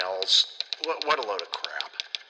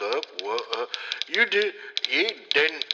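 badly faked Australian or New Zealand accent; botched Antipodean accent; 3 semitones higher than usual. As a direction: attempt an Australian accent and fumble it. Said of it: "I can't even do the accent".

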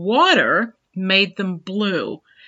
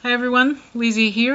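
usual speaking voice

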